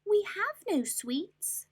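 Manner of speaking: high, slow tiny ant voices. Acted. Ants answering the rat, tiny high chorus. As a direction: squeak it together small. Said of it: "the ants replied".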